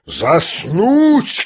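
high-pitched cry from an offended general; indignant shout. A narrator's reading. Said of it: "крикнул генерал". (возмущённый выкрик генерала)

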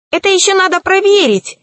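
high, quick, huffy retort; offended. A narrator's reading. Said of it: "обиделся колдун".

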